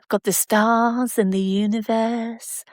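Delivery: slow, drawn-out TV science presenter voice; Brian Cox impression. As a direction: stretch out each word like a documentary host marvelling at space. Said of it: "I'm saying it in his way".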